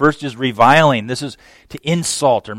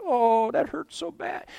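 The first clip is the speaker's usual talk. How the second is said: higher, softer mock whimper; mock a self-pitying victim; whining "poor me" in a small high voice; whiny self-pity; 10 semitones higher, 8 dB quieter than usual. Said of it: "we stroke ourselves".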